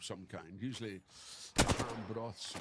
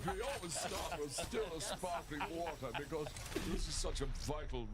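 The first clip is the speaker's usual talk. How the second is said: mock posh; 5 semitones higher than usual. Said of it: "that's how bourgeois you get".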